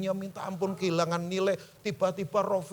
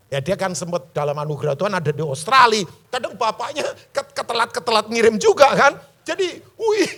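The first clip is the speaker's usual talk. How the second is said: raised voice building to a laugh; loud joking; 3 semitones higher, 10 dB louder than usual. Real, not acted. Father joking to congregation about late money transfers, loud. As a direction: belt out the teasing aside, louder as it goes.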